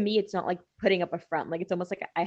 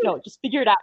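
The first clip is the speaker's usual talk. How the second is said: blunt impatient; 6 semitones higher, 7 dB louder than usual. Retold or imitated. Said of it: "I switch to the Shelby mindset".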